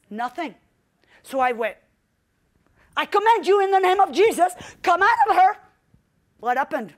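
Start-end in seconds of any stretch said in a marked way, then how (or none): 2.9-5.6 a forceful shouted command, rising in pitch. Retold or imitated. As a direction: raise the voice high and loud, ordering the demon out. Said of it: "My voice started to go higher and higher".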